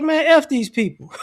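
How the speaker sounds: laughing dismissal. Retold or imitated. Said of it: "they're like".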